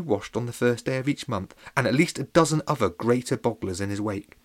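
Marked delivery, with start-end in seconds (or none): none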